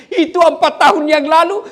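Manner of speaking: hammed-up wife bringing up the past; mock nagging wife. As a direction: play the wife digging up old history. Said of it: "dia bilang".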